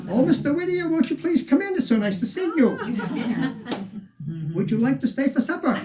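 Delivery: high, loud, sugary welcome at the door; gushing hostess. Retold or imitated. Guide voicing the Quaker hostess welcoming Whittier, high and gushing. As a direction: gush over the guest like an eager hostess.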